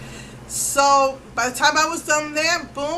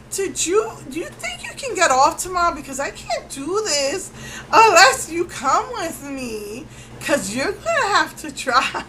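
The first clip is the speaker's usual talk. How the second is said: anxious plea; 5 semitones higher than usual. Retold or imitated. Begging husband to come along.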